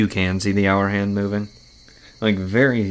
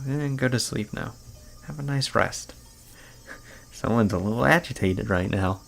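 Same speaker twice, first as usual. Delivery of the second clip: soothing doctor; 4 dB quieter than usual. Acted. Doctor sedating a frightened patient, soft and soothing. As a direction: lull her to sleep like a nurse. Doctor calms agitated girl.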